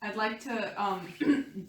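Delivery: ordinary talk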